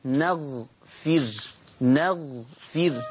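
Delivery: نغفر very slowly: open nun, silent ghain, kasra on fa; Hafs reading. Shown as a demonstration. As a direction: stretch نغفر out, neghfir, fa with kasra. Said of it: "حفص يقرأ بفتح النون وسكون الغين وكسر الفاء".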